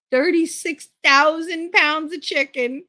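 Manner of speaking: slow, incredulous rant; disbelief. Real, not acted. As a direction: drag it out like you can't believe it. Stuck with a rejected load.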